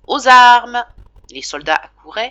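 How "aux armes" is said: loud, high call to arms; sentry's cry. Acted. Sentry calling the soldiers as the viaticum passes, loud cry. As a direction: bark out the alarm. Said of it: "la sentinelle criait".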